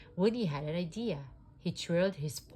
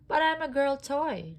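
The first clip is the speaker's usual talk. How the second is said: moaning; 9 semitones higher, 7 dB louder than usual. A narrator's reading. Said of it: "Jessie moaned".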